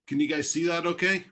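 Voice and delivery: ordinary talk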